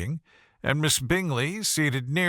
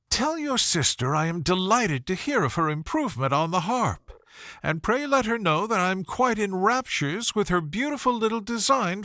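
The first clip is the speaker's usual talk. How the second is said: Miss Bingley admiring; 6 semitones higher than usual. A narrator's reading. Miss Bingley admiring Darcy's writing, cooing and high. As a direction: coo the compliment at him.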